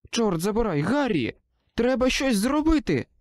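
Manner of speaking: high, rising urgent cry; panicked plea. A narrator's reading. Susan pleads with Harry, panicked rising cry.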